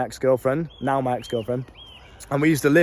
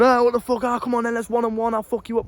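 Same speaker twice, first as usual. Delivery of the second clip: retold shouting match; 8 semitones higher than usual. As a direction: imitate lads squaring up for a fight. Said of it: "screaming at each other and shouting at each other".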